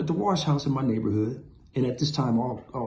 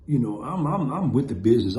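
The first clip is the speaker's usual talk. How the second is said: ordinary talk, nothing marked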